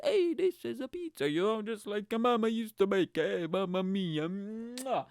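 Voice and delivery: high hammed-up Italian accent with a kiss; mock Italian chef showing off his pizza, kissing his fingers. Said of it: "really stereotypical".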